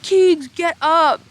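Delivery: high, loud, drawn-out call; imitated impatient yell. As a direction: holler like exasperated friends. Retold friends urging her up.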